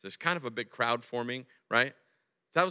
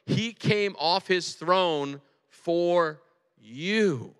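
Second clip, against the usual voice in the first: slow, weighty sermon climax; emphatic preaching; 4 semitones higher, 3 dB louder, slower than usual. Real, not acted. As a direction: press each phrase home slowly, with long pauses. Preaches emotional assurance to congregation.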